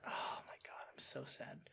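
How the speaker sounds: real hushed dismay; horrified whisper. Real, not acted. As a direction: breathe it out in quiet horror. Rewatching the gory moment.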